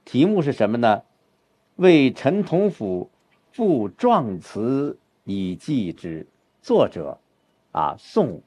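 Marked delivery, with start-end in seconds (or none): 1.8-6.2 slow, weighted reading of the title; slow recitation of the title; paced title reading. Shown as a demonstration. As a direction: read the title with pauses as layers. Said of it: "这样就有层次了".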